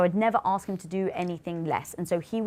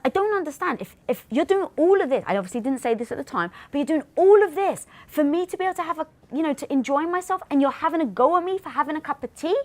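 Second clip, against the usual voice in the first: exasperated complaint; 8 semitones higher than usual. Retold or imitated. Wife replaying her exasperation at her husband over tea. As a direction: let the frustration pour out.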